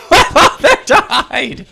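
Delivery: slow, high, loud, laughing; laughing through sad line. Shown as a demonstration. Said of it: "Be like".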